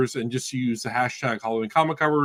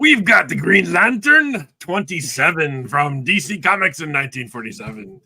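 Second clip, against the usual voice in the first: a hint of a hammy pirate; 3 semitones higher, 7 dB louder than usual. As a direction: lightly growl it like a pirate. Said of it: "I gotta get my pirate voice on for this".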